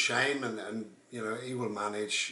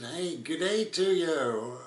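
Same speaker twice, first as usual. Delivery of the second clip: slow, higher-pitched attempt at an Australian accent; bad Australian accent; 5 semitones higher, 4 dB louder, much slower than usual. Traveller greeting the narrator in a badly imitated Australian accent. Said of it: "I can't do Australian".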